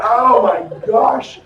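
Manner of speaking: long, loud drawn-out "oh my gosh"; reliving a stunned reaction; amazed. Retold or imitated. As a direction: stretch it out in loud astonishment. Retells amazed reaction to sister.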